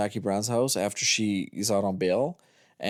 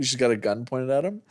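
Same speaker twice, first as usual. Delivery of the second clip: ordinary talk, nothing marked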